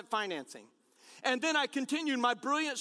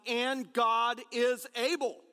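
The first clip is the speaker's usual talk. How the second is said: And God is able, slow, ABLE stressed; slow emphatic refrain; much slower than usual. Shown as a demonstration. Pastor reminding a struggling listener, slow emphatic refrain. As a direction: land hard on ABLE. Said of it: "I came today to remind somebody".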